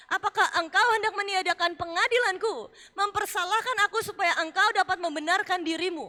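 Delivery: loud, ringing preacher's challenge; preaching at full force. Acted. Preacher voicing God challenging Job, loud and forceful. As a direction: thunder God's questions at the congregation.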